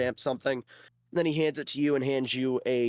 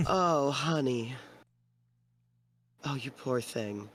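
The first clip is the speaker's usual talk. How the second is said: pitying woman; 3 dB quieter, slower than usual. Acted. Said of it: "and she goes".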